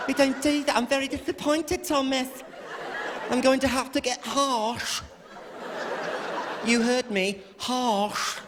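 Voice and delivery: high, composed mock English accent; English nanny. English nanny correcting Thomas, high cool English voice. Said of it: "this English nanny comes over".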